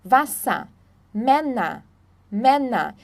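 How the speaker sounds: "Wasser", "Männer" ending in A, no R. Shown as a demonstration. Teacher drilling final ER, "Wasser", "Männer" ending in A. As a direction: say "Wasser" as "vassa", "Männer" as "menna". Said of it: "E R aí no final sempre vai ser A".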